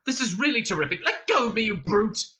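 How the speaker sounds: high, indignant outburst; Arthur spluttering. A narrator's reading. Prisoner to the guard gripping him, spluttering indignantly. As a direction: build from sarcasm to a loud demand. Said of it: "spluttered Arthur".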